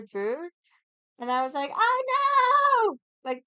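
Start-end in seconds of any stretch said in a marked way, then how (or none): 1.7-3.0 loud high yell of alarm, much higher; retold yell. Yelling at people blocking the path, loud and high. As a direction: cry out across the path in alarm. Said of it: "Who the fuck is yelling?".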